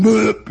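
abrupt put-on blurp; imitated plop. Storyteller imitating custard on the cone, plopping noise. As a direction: sound out custard hitting the cone.

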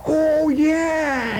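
high, cracking mock shout; mocking outburst. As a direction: hoot it out in disbelief. Father's mocking outburst, voiced.

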